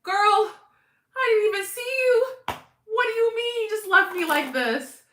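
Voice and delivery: high, mock-offended complaint; hammed-up betrayed friend; playful whine at a friend who left; mock hurt. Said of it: "it's like".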